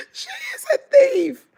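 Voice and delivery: high, wailing mock outrage; exaggerated high cry of disbelief. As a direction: wail it out like the theft is a tragedy. Mock outrage over a thief.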